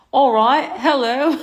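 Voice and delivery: laughing, high hammed-up British accent. Streamer asked by chat for an accent, laughing British voice. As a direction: put on a playful British lilt, giggling. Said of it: "Please do an accent for us".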